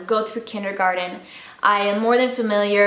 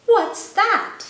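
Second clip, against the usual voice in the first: high, eager little raccoon; curious high child; 12 semitones higher, 3 dB louder than usual. Acted. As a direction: ask it like an eager kid. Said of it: "asked Chester".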